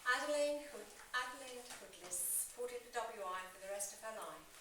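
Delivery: ordinary delivery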